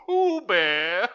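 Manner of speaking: high and loud through laughter; laughing. Real, not acted. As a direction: say it while cracking up. Laughing at photo.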